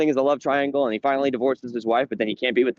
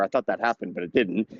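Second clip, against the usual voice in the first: usual speaking voice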